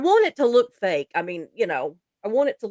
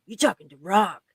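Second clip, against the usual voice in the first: tough guy voice; 3 semitones lower, 6 dB quieter than usual. Retold or imitated. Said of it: "his tough guy voice".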